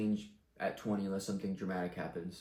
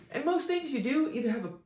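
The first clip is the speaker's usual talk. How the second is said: laughing; 7 dB louder than usual. Real, not acted. High far voice laughing.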